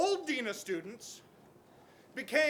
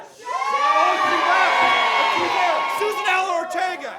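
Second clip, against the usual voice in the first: loud, higher shout pointing her out; calling her out; 7 semitones higher, 14 dB louder than usual. Real, not acted. Protester calling out the official sitting in the crowd, loud high shout. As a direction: yell it out, singling her out.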